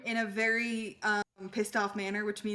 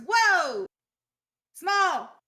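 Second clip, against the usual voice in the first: higher, loud exclaim at something tiny; amazed; 7 semitones higher, 4 dB louder than usual. Acted. Garlic staring at tiny Galba, loud and amazed. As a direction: gasp at how tiny he is. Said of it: "Garlic turns to Galba and goes".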